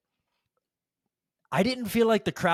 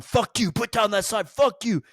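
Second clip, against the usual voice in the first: retold yelling; 8 semitones higher than usual. Dad yelling at a couple over their sign, aggressive. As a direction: imitate an aggressive dad yelling, much higher. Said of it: "just started yelling".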